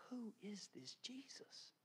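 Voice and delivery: slow, whispered wondering question; awed whisper. Real, not acted. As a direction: let the question out slowly under the breath. Awed question after tender scene.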